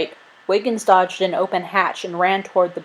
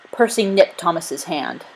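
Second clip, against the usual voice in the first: usual speaking voice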